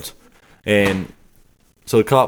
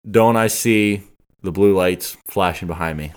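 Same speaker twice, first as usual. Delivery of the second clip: normal talking voice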